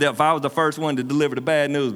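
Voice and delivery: normal talking voice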